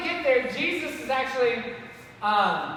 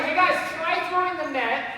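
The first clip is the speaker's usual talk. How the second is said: raised, high-pitched call; 5 semitones higher, 4 dB louder than usual. Acted. Man on shore advising the fishermen, calling out. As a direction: call instructions out to a boat. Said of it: "this guy yelled out".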